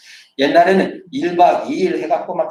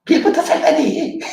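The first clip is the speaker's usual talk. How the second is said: sulky retort; 11 semitones higher, 4 dB louder than usual. Retold or imitated. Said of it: "입이 나와가지고".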